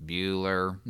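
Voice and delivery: low, quiet, droning teacher calling roll; bored roll call. Retold or imitated. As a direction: drone the name like a dull teacher. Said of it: "the guy's calling roll".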